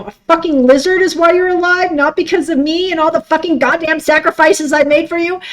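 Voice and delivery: angry rant. Retold or imitated. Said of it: "I screamed that in my head".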